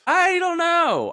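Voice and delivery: high, loud, slow uncertain whine; drawn-out unsure. Real, not acted. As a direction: stretch it out unsure. Unsure playful answer.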